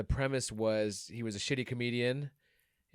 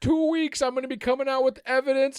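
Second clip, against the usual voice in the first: Mike Lindell impression; 11 semitones higher, 7 dB louder than usual. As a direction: hype it like a pillow salesman on TV. Impersonating Mike Lindell announcement.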